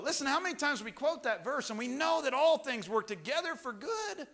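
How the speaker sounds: loud, high sermon delivery; preaching at full force. Acted. Preacher assuring the church God cares, loud preaching. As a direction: drive it home to the congregation.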